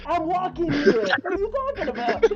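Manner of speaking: loud, high New York "I'm walking here"; New York accent. Retold or imitated. Joking about her East Coast sound, loud New York cabbie. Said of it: "I do that accent".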